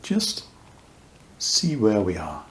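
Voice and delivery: unhurried meditation voice with long pauses; guided meditation. Real, not acted. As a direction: guide listeners gently into awareness, leaving space between phrases.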